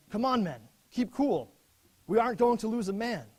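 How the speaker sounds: officer rallying his men under bombardment, calling out loud. Retold or imitated. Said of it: "and he said".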